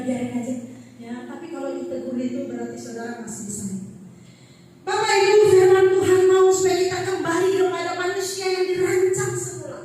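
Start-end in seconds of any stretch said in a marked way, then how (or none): 4.8-9.8 loud, chanting sermon voice; full-force preaching. Acted. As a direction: proclaim it to the whole church.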